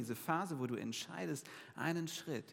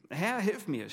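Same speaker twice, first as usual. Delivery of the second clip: louder and higher, a desperate cry; sinking cry for help; 5 semitones higher, 7 dB louder, slower than usual. A narrator's reading. Peter sinking in the waves, crying to Jesus for help. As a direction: cry out as you go under. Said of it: "schrie er".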